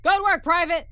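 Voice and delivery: loud, high sergeant's shout; called out. A narrator's reading. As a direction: call it out to the private. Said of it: "the sergeant called out".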